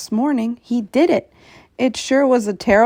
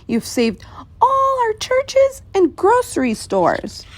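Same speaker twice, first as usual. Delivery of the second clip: much higher, small thankful voice from a speck; a tiny mayor thanking his giant friend; tiny grateful Who; 11 semitones higher than usual. Acted.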